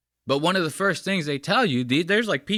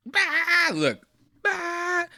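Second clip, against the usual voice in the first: very high squawk imitating the masseuse; mock startled yelp; much slower than usual. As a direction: squawk it like a frightened woman. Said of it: "she's literally like".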